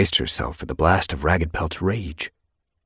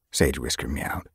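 ordinary delivery

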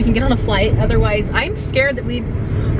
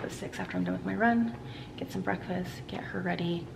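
whispering; 2 semitones lower, 14 dB quieter than usual. Real, not acted. Whispering so others sleep.

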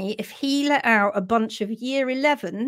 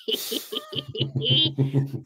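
high, drawn-out giggle; real high laughter; giggling. Real, not acted. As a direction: giggle helplessly at your own joke. Laughs after joking.

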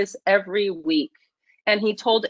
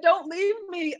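mock pleading; 7 semitones higher than usual. Boss begs her to stay.

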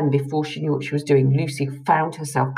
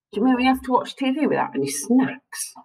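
moaning; 9 semitones higher than usual. A narrator's reading. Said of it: "moaned Ella".

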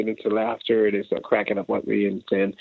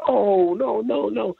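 much higher, amazed no; stunned disbelief; 10 semitones higher, much slower than usual. Retold or imitated. Randy hearing the beat, amazed disbelief. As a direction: react as if you can't believe it.

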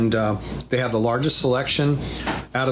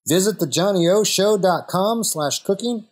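higher, upbeat ad read; promo read; 6 semitones higher than usual. Acted. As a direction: pitch the website like a sponsor spot. Closing promo plug.

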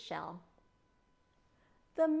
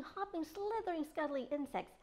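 ordinary delivery

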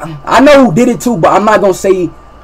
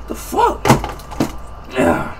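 ordinary delivery

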